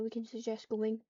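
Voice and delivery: ordinary talk, nothing marked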